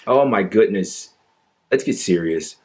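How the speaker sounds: ordinary delivery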